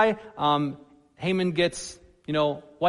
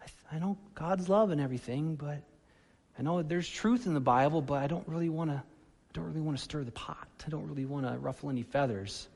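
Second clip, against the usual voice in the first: timid hedging; 2 semitones lower, 8 dB quieter than usual. Retold or imitated. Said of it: "than just".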